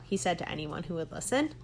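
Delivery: usual speaking voice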